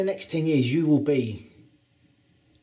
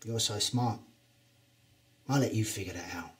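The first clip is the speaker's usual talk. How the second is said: sly taunt; 4 semitones lower, 7 dB quieter than usual. Acted. Taunting wrestler before match.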